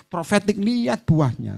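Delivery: normal talking voice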